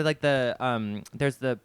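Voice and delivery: ordinary delivery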